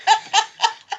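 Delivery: quick high laugh; a burst of giggles; giggling at the memory; short high giggle. Real, not acted. Laughing at her own prank.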